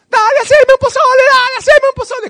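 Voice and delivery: hammed-up baby voice of a grown man; three-year-old child. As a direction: beg like a toddler pestering his mom. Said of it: "como si fuera un niño de tres años".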